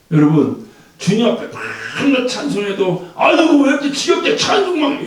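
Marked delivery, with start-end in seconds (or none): 3.1-5.1 grumbling complaint. Retold or imitated. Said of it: "그런 사람도 있어요".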